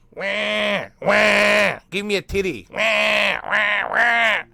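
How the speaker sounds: high-pitched, hammed-up infant bawling; mock baby wailing. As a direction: wail like a demanding baby, jokingly. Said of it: "'cause as a baby".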